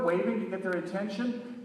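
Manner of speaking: plain reading voice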